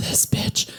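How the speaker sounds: unvoiced mocking whisper; whispered insult. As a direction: hiss it under your breath like an annoyed passenger. People behind her muttering.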